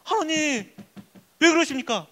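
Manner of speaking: high-pitched protest to God; plaintive prayer. Acted. Believer asking God why nothing changes, plaintive. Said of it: "요셉이 안 그럴까요?".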